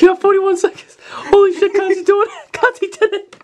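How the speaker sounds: high, loud disbelief; excited shout. Real, not acted. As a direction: yell it in amazement. Cheering as the time runs out.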